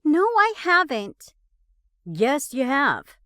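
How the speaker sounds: loud high bickering; angry. A narrator's reading. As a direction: snap back hard. Heated quarrel.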